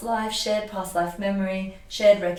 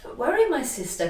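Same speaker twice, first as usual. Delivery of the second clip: gentle guide; 7 semitones higher than usual. Retold or imitated. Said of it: "she says".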